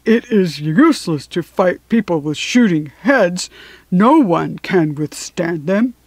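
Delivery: lightly voiced lion, slowing at the end; bruised, sore lion. A narrator's reading. Bruised lion reporting to Dorothy, lightly voiced and sore. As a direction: give the lion a mildly sore voice. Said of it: "feeling rather bruised and sore".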